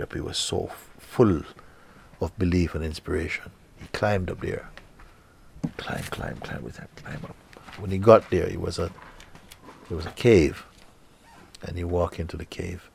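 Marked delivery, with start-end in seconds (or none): 5.8-7.4 whispered, climbing step by step; hushed climb. A narrator's reading. Telling the climb to the listener, a hushed whisper. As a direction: breathe the climb out under your breath.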